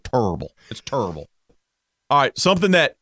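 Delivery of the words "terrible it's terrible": mild imitation, vowels squashed; Charles Barkley. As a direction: slip into a low-key celebrity imitation. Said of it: "it's Charles Barkley was".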